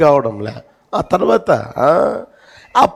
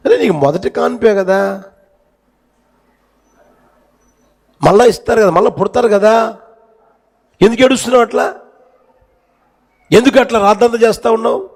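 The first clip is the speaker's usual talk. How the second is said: neighbor scolding; 7 semitones higher, faster than usual. Retold or imitated. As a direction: shake her out of her grief with rising scolding. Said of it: "ఆమె అంటది".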